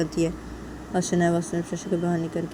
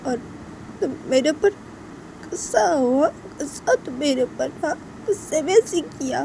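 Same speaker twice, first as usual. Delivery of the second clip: higher-voiced tearful reproach; hurt, upset Shanaya; 10 semitones higher, 4 dB louder than usual. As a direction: scold a friend through hurt, in broken phrases. Voices hurt Shanaya scolding Tisha. (आहत, रुआँसी आवाज़ में शिकायत)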